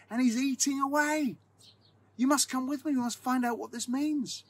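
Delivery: high, urgent, breathless voice; panicked plea. A narrator's reading. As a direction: beg him to come, full of panic. Sexton in panic wakes housemate.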